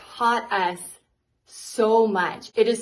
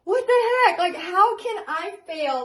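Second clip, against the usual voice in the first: devastated disbelief; 8 semitones higher than usual. Retold or imitated. Young painter at her empty booth, high and despairing. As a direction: plead at the sky, as if failing is impossible. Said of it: "And I am devastated".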